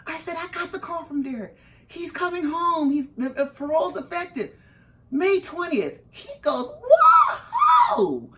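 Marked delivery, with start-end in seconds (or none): 0.4-6.0 high-pitched, breathless-with-news retelling; excited announcement. Retold or imitated. Calling the attorney about parole, excited. 6.8-8.3 very high, loud whoop; ecstatic shout. Retold or imitated. Attorney hearing parole news, whooping loudly. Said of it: "So loud that the people in the hallway".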